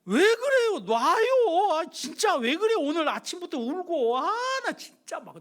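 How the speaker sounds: high, loud exasperated shout; angry protest. Retold or imitated. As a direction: shake her off in fury. Son shouting at clinging mother. (어머니에게 높고 크게 짜증내며 외침)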